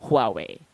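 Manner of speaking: "Huawei" as HWAH-way with an audible H; H pronounced at start. Shown as a demonstration. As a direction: model "Huawei" with a slight breath before the W. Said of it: "with a slight breath at the beginning".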